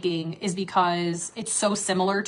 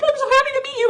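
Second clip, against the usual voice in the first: high squealing mock fan, much higher; mock tearful fan; 4 dB louder, faster than usual. Fans crying to meet her, high mock gushing. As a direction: gush like a crying starstruck fan. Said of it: "crying 'cause they're like".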